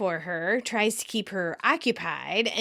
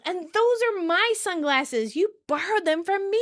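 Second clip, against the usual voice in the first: high-pitched indignant reproach; mock scolding; 9 semitones higher than usual. Phoebe scolds Rachel over borrowed sunglasses, indignant. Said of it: "Phoebe scolds".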